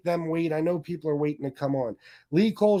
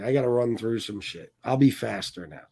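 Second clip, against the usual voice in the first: ordinary talk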